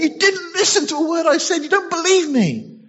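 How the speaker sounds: high, exasperated angel; high angel voice fuming at being doubted; frustrated Gabriel. Retold or imitated. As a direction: vent at the doubter. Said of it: "sense the frustration".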